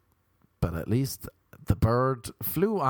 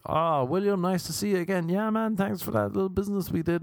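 mock polite greeting; 7 semitones higher than usual. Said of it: "you'd be like".

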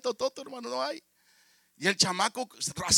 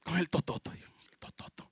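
unvoiced whispered echo; 5 dB quieter than usual. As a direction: whisper it like a puzzled secret. Eco del niño, susurrado. (susurro desconcertado)

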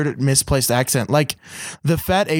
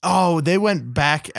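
ordinary talk, nothing marked

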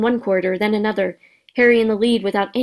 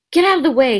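sharp, higher irritated snap; snapping; 5 semitones higher, 4 dB louder, faster than usual. Acted. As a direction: snap at him to move. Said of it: "snapped Ron".

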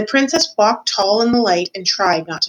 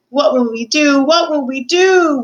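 loud, raised-pitch lament; wailing; 6 semitones higher, 5 dB louder than usual. A narrator's reading. Cook despairing over the lost princess, wailing. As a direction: wail it in acted despair. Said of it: "wailed Cook".

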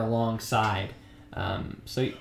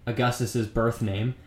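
ordinary delivery

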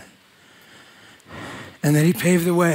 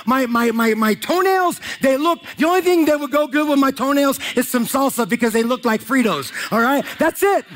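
preaching at full force; 9 semitones higher than usual. Acted. Preacher to the congregation, preaching at full force. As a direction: preach it out big and high to the crowd.

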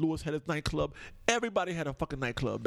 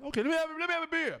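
much higher, silly voice of a guy ordering beer; mock the boring beer drinker; mock beer orderer; 11 semitones higher, faster than usual. Mimicking a bland beer order.

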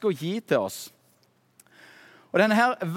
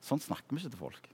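quiet inner voice; 5 semitones lower, 10 dB quieter than usual. Retold or imitated. God rebuking Kristian's conscience, soft and gentle. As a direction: speak it softly like a conscience. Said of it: "Gud som prøvde å si".

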